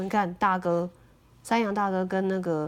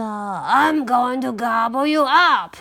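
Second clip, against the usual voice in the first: loud troll threat, swelling to the end; menacing troll; 6 dB louder than usual. A narrator's reading. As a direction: threaten loudly as a hungry troll. Troll threatens the little goat.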